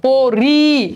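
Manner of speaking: পরি split into long "po" and "ri"; syllable-by-syllable demonstration. Shown as a demonstration. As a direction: stretch both syllables of পরি. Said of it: "উচ্চারণ করো".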